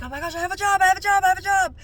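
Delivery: bursting with joy over the job; excited. Retold or imitated. Thrilled at getting hired.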